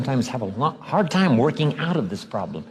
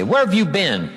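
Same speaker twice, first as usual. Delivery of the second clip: loaded sarcasm; 6 semitones higher than usual. Retold or imitated. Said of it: "See, that's loaded".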